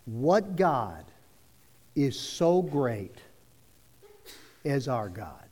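slow, spaced-out phrases falling low; very slow dictation. Shown as a demonstration. Preacher dictating words for people to write, very slow. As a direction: dictate slowly, pausing between phrases. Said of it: "I'm gonna say 'em very slow".

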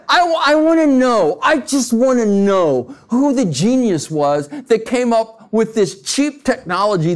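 high-pitched exasperated complaint; exasperated rant. Real, not acted. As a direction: vent your frustration, voice climbing high. Ranting about cheap toilet technology.

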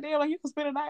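high, casual mock invitation; lightly voiced boyfriend; imitating the detached boyfriend; mock boyfriend offer. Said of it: "he was like".

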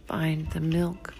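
ordinary delivery, nothing marked

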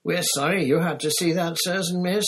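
high, squeaky ashamed elf voice; ashamed house elf apologizing; embarrassed elf squeaking sorry; apologetic squeak. Acted. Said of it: "squeaked a nearby elf".

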